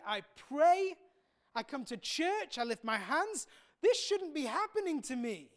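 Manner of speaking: self-pitying complaint. Retold or imitated. Preacher voicing a grumbling believer, indignant whine. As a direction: protest like a hard-done-by churchgoer. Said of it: "sometimes we can say".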